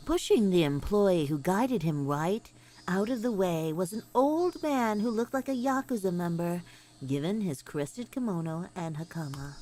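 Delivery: low, grave narrator voice; story narration. Acted. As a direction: read it like a dark novel narrator.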